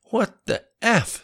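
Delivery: very high, drawn-out incredulous exclamation; shocked disbelief. A narrator's reading. Man told his companions were arrested, stunned. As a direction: stretch it out, voice leaping up in shock.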